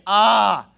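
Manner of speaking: "Ah!" as a loud open vowel; loud, exasperated teaching "Ah!"; emphatic letter sound. Shown as a demonstration. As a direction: belt out "Ah!" to drill the alpha sound. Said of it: "Alpha says".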